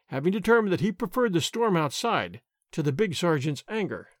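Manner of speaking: usual speaking voice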